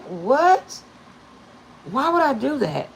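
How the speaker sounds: high, loud incredulous what; mock disbelief. Mother retelling her shock at her daughter's advice, high and loud. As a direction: react in stunned disbelief. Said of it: "And I was like".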